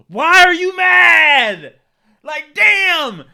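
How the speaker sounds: high, loud disbelieving yell; incredulous shout. Real, not acted. Vlogger shouting at a commenter's complaint, incredulous. Reacting loudly to reader comment.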